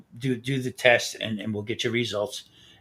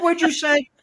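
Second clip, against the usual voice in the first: incredulous retort; 5 dB louder than usual. Retold or imitated. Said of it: "I said".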